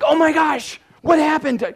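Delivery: loud, high mock panic; panicked shout. Congregation mocking their own panic in the storm, shouted high and loud. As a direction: shout it like people caught off guard by a storm. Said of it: "and we're like".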